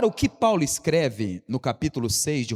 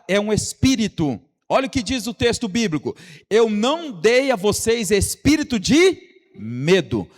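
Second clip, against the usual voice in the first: loud, higher-pitched sermon delivery; insistent, raised preacher's voice; full-force preaching; 5 semitones higher, 7 dB louder than usual. Real, not acted. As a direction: drive each point home to the congregation.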